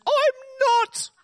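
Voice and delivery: put-on very high comic old man's protest. Old man contradicting his son, high protesting voice. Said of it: "And the old man says".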